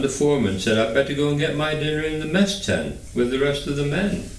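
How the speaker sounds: usual speaking voice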